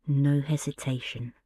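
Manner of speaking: usual speaking voice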